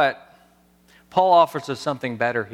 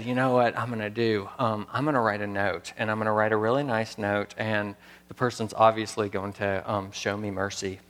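hushed; 5 semitones lower, 6 dB quieter than usual. Retold or imitated. Retold own hopeful plan, hushed.

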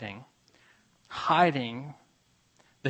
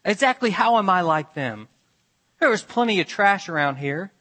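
loud, higher, indignant complaint; fist-shaking protest; 5 semitones higher, 6 dB louder than usual. A narrator's reading. Woman protesting to God with a raised fist, loud. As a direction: shake your fist at heaven as you say it. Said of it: "She shook her fist".

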